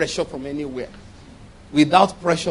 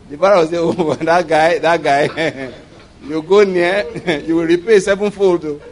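loud, chuckling through the words; amused, breaking into chuckles between phrases; laughing while talking; 5 dB louder than usual. Real, not acted. Chuckles through a joking imitation.

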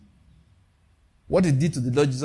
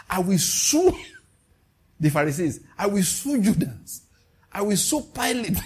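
higher, rising with each I will sue; a preacher playing Jesus' lawyer, building mock outrage; mock lawyer's zeal; 5 semitones higher than usual. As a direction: play an eager lawyer piling up lawsuits.

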